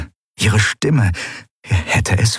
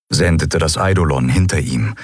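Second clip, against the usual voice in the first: plain reading voice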